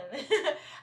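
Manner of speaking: quick embarrassed laugh; a little self-conscious chuckle; short laugh. Real, not acted. Laughs at own fumbling.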